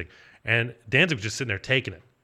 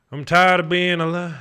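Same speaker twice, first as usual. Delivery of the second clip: mock mopey rock singer; 6 semitones higher, 6 dB louder, slower than usual. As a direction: drag it out like a bored rock star groaning. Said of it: "like that".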